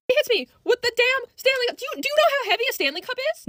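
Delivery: high-pitched comic indignation; outraged disbelief. Retold or imitated. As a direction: tell it in incredulous, high-voiced outrage. Outraged retelling of being hit.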